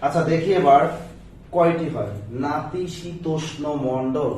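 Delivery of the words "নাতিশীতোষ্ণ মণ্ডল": নাতিশীতোষ্ণ মণ্ডল drawn out syllable by syllable; slow careful pronunciation. Shown as a demonstration. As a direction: stretch out নাতিশীতোষ্ণ slowly for the class. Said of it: "দেখেন". (ধীরে ধীরে স্পষ্ট উচ্চারণ)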